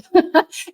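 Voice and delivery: short high laugh; burst of laughter. Real, not acted. Host laughing about social media comments, short bright laugh. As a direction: laugh out at the absurdity.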